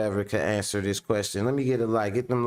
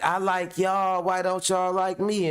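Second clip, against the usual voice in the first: mock whiny [name]; 6 semitones higher than usual. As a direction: play [name] begging to be liked, a bit higher. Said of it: "He like".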